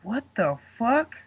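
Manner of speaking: slow, hushed, stunned what-the-fuck; stunned disbelief. Retold or imitated. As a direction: let it slip out quietly in slow shock. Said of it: "and I'm like".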